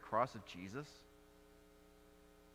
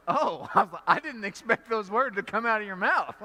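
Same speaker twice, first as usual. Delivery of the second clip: higher, louder, laughing in disbelief; laughing surprise; 6 semitones higher, 9 dB louder than usual. Retold or imitated. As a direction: blurt it out laughing, caught off guard. Retold shock at Tom's answer.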